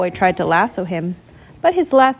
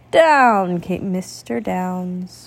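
ordinary delivery

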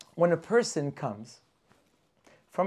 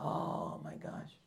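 dawning realization; 11 semitones lower, 12 dB quieter, slower than usual. Acted. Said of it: "He says".

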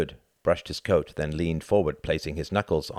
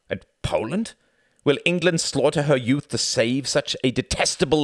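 higher, clipped-free insistent diplomat pleading; German envoy voice; 10 semitones higher, 6 dB louder than usual. A narrator's reading. Envoy courting Churchill, higher and louder persuasion. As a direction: press the offer as a smooth German envoy.

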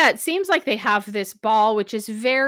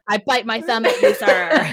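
hammed-up old-stage taunt, rising at the end; mock theatrical threat, laughing through it; Shakespearean insult; 4 semitones higher than usual. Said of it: "Romeo and Juliet style".

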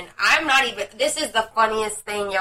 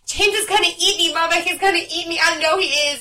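mimicking scared child; 5 semitones higher than usual. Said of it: "I was like".

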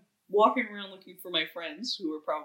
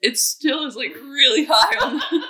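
laughing; 8 semitones higher, 11 dB louder than usual. Real, not acted. Laughing about the noise.

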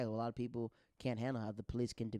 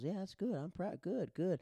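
higher, mock-kind humoring voice; amused polite praise; 8 semitones higher, slower than usual. Dad humors the bragging sergeant, higher mock praise. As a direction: pat him on the head with the words.

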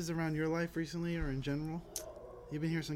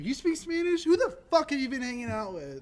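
higher, louder mock shock; 10 semitones higher, 6 dB louder than usual. To the lover, a higher mock-shocked question. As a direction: act stunned she speaks Spanish. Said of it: "You're like".

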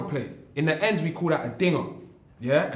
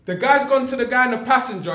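usual speaking voice

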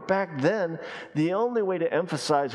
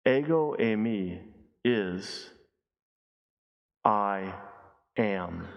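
low and hushed, a pause before each word; solemn slow reveal; 6 semitones lower, slower than usual. Shown as a demonstration. Preacher revealing the meaning to the congregation, slow hushed reveal. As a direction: let I am land slowly. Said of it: "literally translated is simply this".

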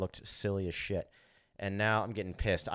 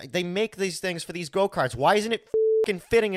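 higher and louder exasperation; building annoyance at the part; rising angry complaint; frustrated outburst; 9 semitones higher, 7 dB louder than usual. Retold or imitated. Frustrated under the kart.

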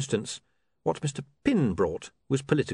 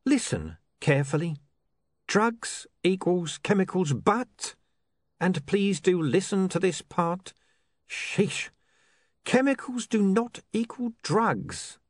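higher, slow, pointed explanation; patient lecturing crook; 8 semitones higher than usual. Acted. Mister Pin lectures his partner on chemicals, slow, high and pointed. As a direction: spell it out for a slow pupil.

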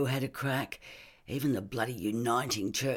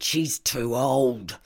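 rigid with anger; 2 semitones higher, 7 dB louder, much slower than usual. Acted. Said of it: "He was rigid with anger".

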